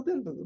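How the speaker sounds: quick burbling dribble noise; imitated egg trickle. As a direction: burble like a fake egg running down a head. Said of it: "fake egg on someone's head and it would go".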